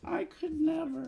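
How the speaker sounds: very high, sly little lizard voice; chameleon. Acted. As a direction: squeak it up high like a scheming tiny lizard. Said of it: "When you talk in that little lizard voice".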